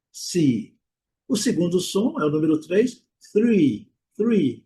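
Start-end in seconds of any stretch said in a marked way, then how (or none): 3.3-4.6 three with tongue between teeth; tongue between the teeth on three, releasing air; English th. Shown as a demonstration. Teacher modeling three for students. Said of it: "Temos o T H aqui".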